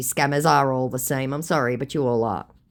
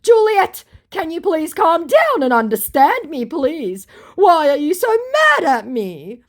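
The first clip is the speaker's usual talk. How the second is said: high, pleading scammer voice, louder; whiny scammer; 13 semitones higher, 6 dB louder, slower than usual. Retold or imitated. Vlogger mocks her scammer, high and pleading. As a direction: mimic the scammer begging her to calm down. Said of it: "he goes".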